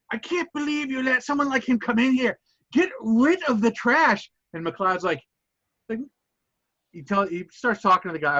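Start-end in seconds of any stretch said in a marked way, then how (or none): 0.1-4.3 higher, disdainful customer voice; snooty customer. Acted. Angry customer complains to the counter about Wild Bill, disdainful voice. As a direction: sneer down at the newcomer. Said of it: "He's like".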